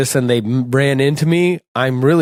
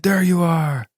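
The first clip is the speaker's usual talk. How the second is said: raised call across the crowd; 3 semitones higher than usual. Retold or imitated. Brother-in-law finding him lost at the show, calling out. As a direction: shout it as if finally spotting him. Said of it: "my brother-in-law was like".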